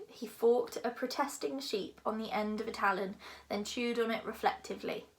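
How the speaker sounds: ordinary delivery, nothing marked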